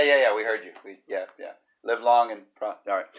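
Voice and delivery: normal talking voice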